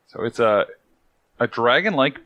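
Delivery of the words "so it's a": normal talking voice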